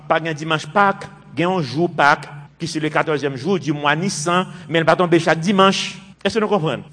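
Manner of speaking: ordinary talk, nothing marked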